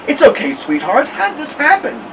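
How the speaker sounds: high, tearful tender voice; near tears. A narrator's reading. Mama soothes her stuck son, near tears. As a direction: comfort him while about to cry. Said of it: "sounded like she was going to start crying".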